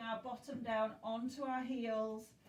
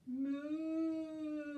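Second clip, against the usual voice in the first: mooing like a cow; 3 semitones higher than usual. Pretend. Teacher repeating the cow pose gives a gentle moo. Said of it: "Let's do our cow again".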